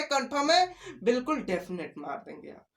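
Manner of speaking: ordinary talk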